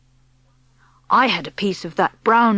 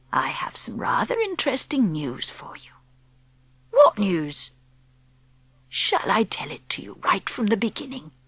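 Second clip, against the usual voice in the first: put-on calm whispery old woman explaining; old grandmother; 6 dB quieter than usual. Grandmother speaks.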